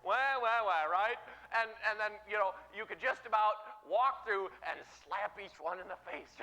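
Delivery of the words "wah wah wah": loud "wah" baby-cry imitation; mock crying. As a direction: fake bawling, hammed up. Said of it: "you quit your whining".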